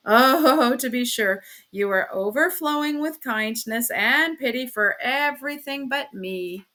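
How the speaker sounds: loud scornful "oh", then aggrieved; jealous, sneering complaint; bitter sarcasm. Acted. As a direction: heap on sarcastic praise, sulking at the end. Sarcastic retort in acted marital quarrel.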